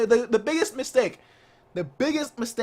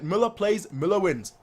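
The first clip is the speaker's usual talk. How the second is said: ordinary delivery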